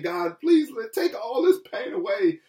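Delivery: high-pitched, imitated plea to God; pleading prayer. Believer asking God to remove the pain, imitated pleading prayer. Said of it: "removing ourselves and praying".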